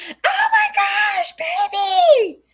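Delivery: high, drawn-out squealing shriek; gagging scream. Retold or imitated. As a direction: shriek it loud and very high, imitating an overexcited friend. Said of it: "gagging, screaming".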